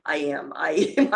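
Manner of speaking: ordinary talk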